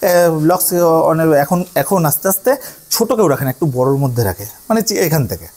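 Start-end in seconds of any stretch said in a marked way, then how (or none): none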